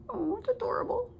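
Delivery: cooing whisper. Real, not acted. Cooing over the cookie cutter shark.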